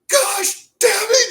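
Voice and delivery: slow, very high mock scream; loud, strained retold outburst; imitated fired-up coach. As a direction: explode as the coach, drawing out each word. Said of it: "Coach Bray's fired up".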